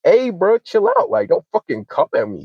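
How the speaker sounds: high-pitched retold "chill out" protest; exasperated protest. Man telling angry friend to back off, exasperated. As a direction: push back, voice jumping up high.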